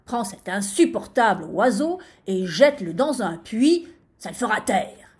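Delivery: loud, exasperated order; commanding. A narrator's reading. Sultan orders sentry to throw the bird away, commanding. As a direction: give a forceful royal command. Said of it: "lui ordonna-t-il".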